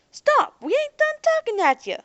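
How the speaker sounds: very loud, very high shout; yelling after them. Acted. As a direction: bellow after the fleeing pair. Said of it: "cried Jake".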